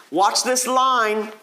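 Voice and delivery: normal talking voice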